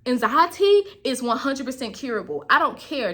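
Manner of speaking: ordinary talk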